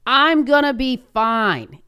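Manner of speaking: slow, high, effortful imitation of dad; struggling to speak. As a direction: drag the words out loudly as if they won't come. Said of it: "He couldn't get the words out".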